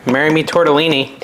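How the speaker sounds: ordinary delivery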